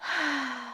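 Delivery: soft, breath-only storybook sigh. Acted. Storyteller plays Cinderella for children, sighing softly. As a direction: let out a long soft sigh. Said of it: "Now, Cinderella sighed".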